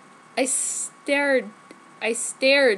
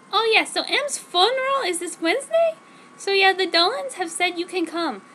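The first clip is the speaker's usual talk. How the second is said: put-on high, louder teen girl voice; girly heroine voice; 7 semitones higher, 3 dB louder than usual. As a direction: play the gushing teenage heroine. Narrator voices herself in quotes.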